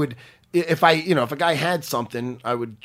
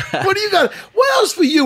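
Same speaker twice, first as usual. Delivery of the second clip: calling out; 14 semitones higher, 3 dB louder than usual. Real, not acted. Calling out to Aljo.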